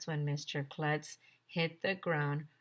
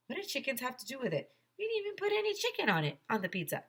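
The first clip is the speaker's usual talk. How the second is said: put-on confused kid; 7 semitones higher, faster than usual.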